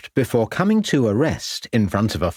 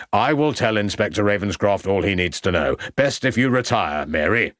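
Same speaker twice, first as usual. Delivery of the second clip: ordinary delivery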